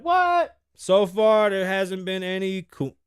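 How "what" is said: shocked what. Retold or imitated. Boys shocked at the news.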